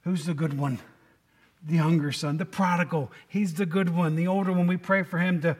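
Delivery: normal talking voice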